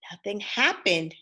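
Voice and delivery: plain reading voice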